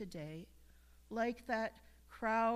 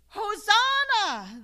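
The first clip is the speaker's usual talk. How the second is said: loud, high, drawn-out cry of praise; shouted hosanna; 10 semitones higher, 13 dB louder, slower than usual. Acted. Preacher calling out the crowd's hosanna, loud. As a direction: proclaim it like the Palm Sunday crowd.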